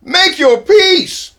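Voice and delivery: high, slow sneering repeat of the demand; mocking scoff; scornful mock echo. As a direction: throw the words back mockingly.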